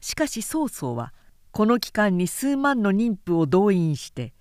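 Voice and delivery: plain reading voice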